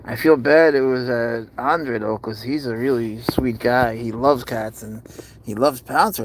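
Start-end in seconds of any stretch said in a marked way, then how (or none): none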